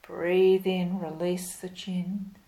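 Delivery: normal talking voice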